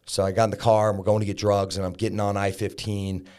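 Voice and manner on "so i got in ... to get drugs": ordinary talk, nothing marked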